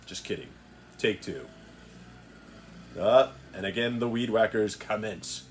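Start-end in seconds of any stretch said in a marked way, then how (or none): none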